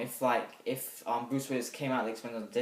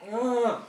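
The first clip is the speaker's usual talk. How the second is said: grunt; 10 semitones higher, 6 dB louder than usual. Retold or imitated. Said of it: "he's like".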